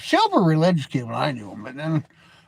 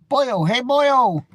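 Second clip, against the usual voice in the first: lightly hammed-up Irish leprechaun, higher and louder; a playful mock Irish "boyo", lightly done; leprechaun impression; 6 semitones higher, 5 dB louder, much slower than usual. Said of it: "That was my leprechaun impression".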